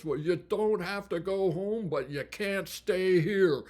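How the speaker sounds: retold yell. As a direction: call it out across the bar to send them home. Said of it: "would yell out at the loudest".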